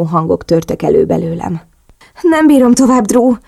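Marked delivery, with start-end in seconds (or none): 2.2-3.4 high, loud, urgent cry; screamed cry. A narrator's reading. Narrator crying out to Drew, high loud scream. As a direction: burst out at the edge of control.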